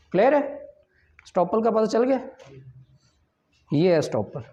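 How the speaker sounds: ordinary talk, nothing marked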